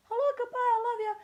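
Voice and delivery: very high, loud sing-song greeting. Pretend. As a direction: chirp it like a cartoon.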